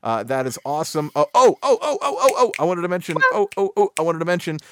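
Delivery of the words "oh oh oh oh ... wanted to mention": high, urgent burst of repeated oh's; excited interjection. Real, not acted. Host suddenly remembers something he wanted to mention, excited. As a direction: jump in eagerly with a sudden remembered point.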